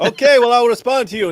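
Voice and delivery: high, loud mock reply; mock curt reply. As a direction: snap back like the debater. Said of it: "He goes".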